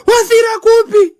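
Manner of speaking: high, loud mock-alarmed cry; dramatic mother. Mother answering her daughter's phone call, dramatic cry. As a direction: fire it back as an overdramatic mother. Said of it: "my mother is also dramatic".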